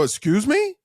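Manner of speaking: indignant high rising question; taken aback. Retold or imitated. Host to friend who ordered water, taken aback.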